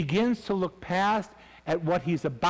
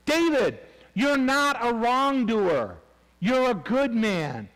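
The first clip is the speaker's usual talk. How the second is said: raised, high, drawn-out proclamation; emphatic exhortation; 8 semitones higher, 4 dB louder, slower than usual. Acted. Abigail telling David who he really is, emphatic exhortation. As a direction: call it out high and strong, stretching the words.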